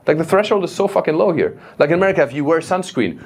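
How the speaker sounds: ordinary delivery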